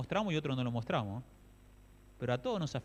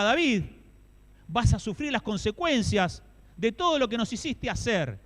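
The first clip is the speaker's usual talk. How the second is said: loud, raised preaching voice; impassioned preaching; 9 semitones higher, 7 dB louder than usual. Acted. Preacher to the congregation about David's friends, loud preaching. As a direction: press the point home, raised.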